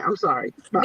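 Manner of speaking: a hint of a Nigerian auntie saying goodbye; mock Nigerian accent brushing someone off. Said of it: "Now I'm going to my Nigerian accent".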